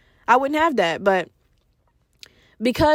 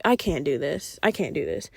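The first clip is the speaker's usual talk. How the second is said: defeated; 8 semitones lower, 6 dB quieter than usual. Retold or imitated. Giving up on friend.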